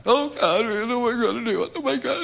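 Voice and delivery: high, fast, frantic praying; panicked prayer. Acted. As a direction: spiral into panic, voice climbing.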